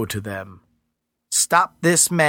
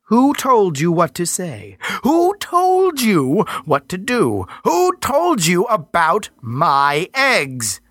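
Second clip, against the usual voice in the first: slow, louder demanding goddess; stern interrogation; 7 semitones higher, 4 dB louder, slower than usual. Acted. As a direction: press him with each question. Earth Mother interrogates.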